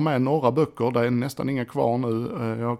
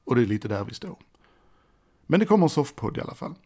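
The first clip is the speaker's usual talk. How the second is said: ordinary talk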